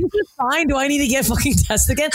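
high, fake-calm voice cracking into panic; mock panic. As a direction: pretend it's fine while freaking out. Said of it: "And I'm like".

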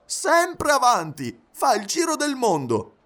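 high, drawn-out enthusiastic exclamation; animated high-pitched valet; excited announcement. A narrator's reading. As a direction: reveal it eagerly, pitch right up. Valet excitedly reveals master's journey.

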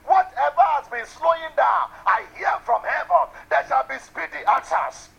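loud, high fervent preacher; preaching at full force. Real, not acted. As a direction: build up into a shouting prayer. Preacher builds to fervent prayer.

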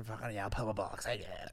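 fast blurted camp voice; camp fan impression. Imitating a fan blurting out rude offers, fast and camp. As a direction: spit it out like a tic.

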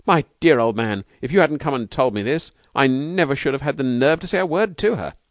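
high, loud, delighted outpouring; overjoyed. A narrator's reading. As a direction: gush thanks, then rush to get away. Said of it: "stammering in his delight".

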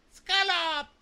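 very high, loud squeaky echo; clueless parroting. Retold or imitated. Yuki repeats a word she doesn't understand, squealed parroting. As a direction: parrot the word back in a piercing cartoon voice. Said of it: "she's just repeating words".

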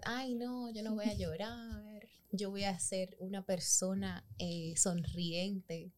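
soft, slow self-talk promising not to cry; quiet, slowed-down inner pledge; quoting her calm resolve. Retold or imitated. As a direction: hush it like a vow to yourself. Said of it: "yo debo decir que yo estaba".

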